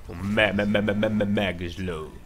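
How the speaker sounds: playful slow-motion stammer; mock slowed stutter. Slowed-down stutter mimicking a slow effect.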